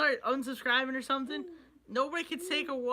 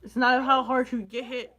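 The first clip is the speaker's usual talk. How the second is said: louder, with a laugh through "it's not how hard you get hit"; laughing quote; 8 dB louder than usual. Real, not acted. Vlogger laughing at himself for fumbling a quote. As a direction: laugh out the half-remembered quote. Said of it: "I forget the fucking quote".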